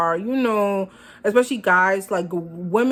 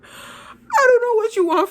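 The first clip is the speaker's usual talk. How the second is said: much higher, louder, voice cracking near a cry; exasperated wail; 6 dB louder than usual. Retold or imitated. Answering her fickle date, exasperated high wail. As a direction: throw your hands up with your voice.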